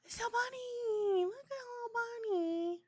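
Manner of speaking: overjoyed squeal at a familiar face; excited. Real, not acted. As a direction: call it out thrilled at spotting him. Excited recognition of Albani.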